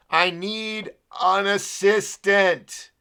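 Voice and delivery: loud, slow, drawn-out exasperation; fed-up cry for backup; exasperated outburst. Real, not acted. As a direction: stretch out each word in real frustration. Fed up working the show alone.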